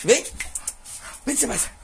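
high sing-song call; high coaxing call to the dog. Real, not acted. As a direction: coax the dog over in a high voice. Calling the dog.

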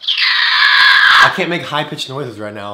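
sick, hoarse voice; rough voice from a cold. Real, not acted. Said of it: "I'm currently recovering from being sick".

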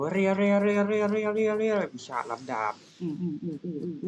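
high, loud battle yell of เรีย เรีย; battle cry. Acted. Gamer voicing his swordsman's attack, a high loud battle yell. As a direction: scream the attack cries like an anime swordsman.

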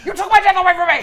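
high, loud villain's accusation; hammed-up rage at being robbed; mock furious shout. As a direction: yell it as a wronged devil. Co-host shouts as aggrieved devil.

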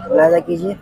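normal talking voice

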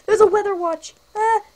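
sudden loud alarm; startled. Real, not acted. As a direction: blurt it out startled.

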